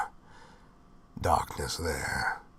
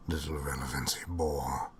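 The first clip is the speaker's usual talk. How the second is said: usual speaking voice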